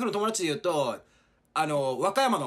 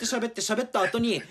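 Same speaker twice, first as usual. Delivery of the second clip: ordinary talk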